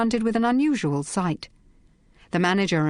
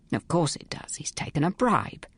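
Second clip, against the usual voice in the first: unvoiced whisper; 3 dB quieter than usual. Inspector whispering aside to Phryne, unvoiced. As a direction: hiss it privately, breath only.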